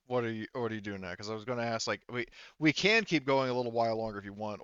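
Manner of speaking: ordinary talk, nothing marked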